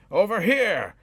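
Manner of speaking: loud high shout across the cavern. A narrator's reading. Wizard calling the dragon's attention, shouting high. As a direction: yell to pull the dragon's eyes. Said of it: "Aristobulus shouted".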